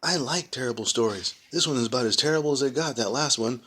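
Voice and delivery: put-on keen little boy begging; eager child voice. Child voice continues.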